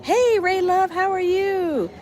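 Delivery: bright greeting. Real, not acted. Greeting a viewer in chat.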